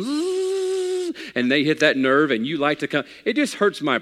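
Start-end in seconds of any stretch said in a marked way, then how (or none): 0.0-1.1 high buzzing drill noise; dentist drill buzz. Retold or imitated. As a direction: imitate a dental drill whine. All of a sudden they're drilling.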